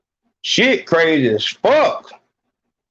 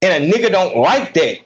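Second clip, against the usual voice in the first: ordinary delivery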